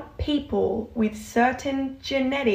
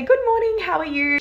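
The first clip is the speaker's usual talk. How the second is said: much higher and louder, beaming hello; cheerful greeting; 8 semitones higher, 5 dB louder than usual. Retold or imitated. To a neighbour at her door, a bright smiling good morning. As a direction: greet her with a big smile in the voice. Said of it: "with a big smile".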